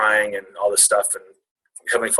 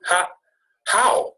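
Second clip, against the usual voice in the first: retold disbelief; 10 semitones higher than usual. Acting out shock at pregnancy news.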